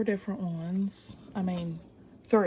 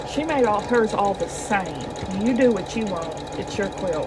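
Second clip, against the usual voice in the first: emphatic; 8 dB louder than usual. Real, not acted. Defiant remark about quilting her way.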